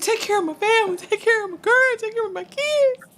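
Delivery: high, earnest plea; dying Robbie quote. Retold or imitated. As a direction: beg him to care for the family. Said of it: "He said".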